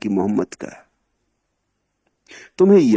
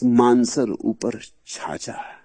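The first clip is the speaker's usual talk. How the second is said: slow recitation; much slower than usual. Shown as a demonstration. Verse line drawn out.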